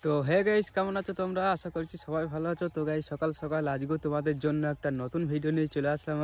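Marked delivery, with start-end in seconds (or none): none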